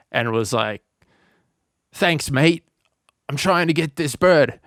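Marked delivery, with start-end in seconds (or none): 1.9-4.6 higher, hammed-up Aussie bloke; a deliberately bad Australian accent, mock casual. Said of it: "excuse my horrible Australian accent".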